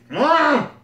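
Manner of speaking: ordinary talk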